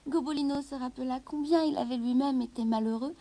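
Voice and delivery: ordinary delivery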